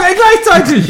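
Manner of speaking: high, loud delighted yell; excited shout. Real, not acted. Burping at the same time.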